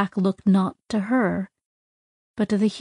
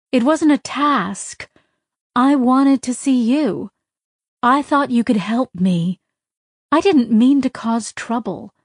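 raised, higher, louder and hurt; hurt anger; 4 semitones higher, 4 dB louder than usual. A narrator's reading. Sidra to Tak, insisting she came herself, hurt and angry. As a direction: push back, wounded and angry.

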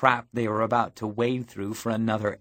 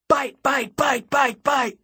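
high, raised rapid-fire chant; crowd game cry; 11 semitones higher, 4 dB louder than usual. A narrator's reading. Vamps calling all around the plaza, high chanted cry. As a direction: call it out high in punchy repeats.

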